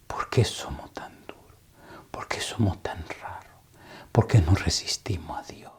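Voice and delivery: a weeping woman's hushed confession; tearful whisper. Retold or imitated. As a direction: whisper it as if she is close to tears. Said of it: "con los ojos llenos de lágrimas".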